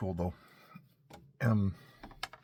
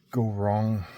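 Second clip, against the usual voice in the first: ordinary delivery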